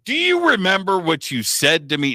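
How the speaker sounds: high, eager call of dude; rising, delighted reunion question; old friend thrilled to ask the sculptor about the past, high and eager; excited greeting. Retold or imitated. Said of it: "And I go".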